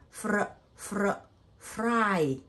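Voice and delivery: "fr", "fr", then a long "fry"; sounding out FRY. Shown as a demonstration. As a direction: build "fry" from two short "fr" sounds. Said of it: "I have I sound".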